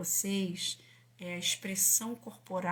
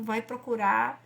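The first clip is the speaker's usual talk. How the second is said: ordinary delivery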